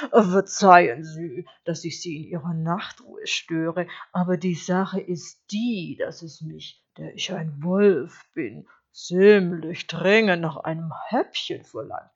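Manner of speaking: lightly voiced courteous wolf with heavy emphasis; smooth-talking wolf, polite. Hungry wolf apologising to the sheep, formally polite. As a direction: ask politely, leaning on key words.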